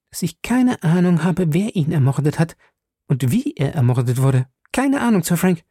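Betty swearing innocence to Sir Frank; young lady Betty. A narrator's reading. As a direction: plead your innocence earnestly. Betty's pleading confession.

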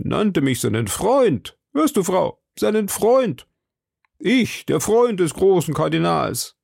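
high, swelling, self-important boast; boastful pride. A narrator's reading. Husband boasts of being the Cardinal's friend, proud. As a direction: relish the honour with swelling pride.

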